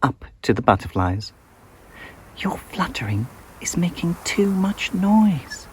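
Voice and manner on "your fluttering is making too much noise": higher, gentle scolding voice; lightly voiced little scold; Daisy scolding.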